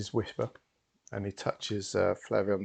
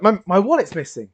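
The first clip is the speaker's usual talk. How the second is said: high, loud startled exclamation; alarmed discovery; 10 semitones higher, 11 dB louder than usual. Acted. Diner finds his wallet gone, loud and alarmed. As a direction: blurt it out in sudden alarm.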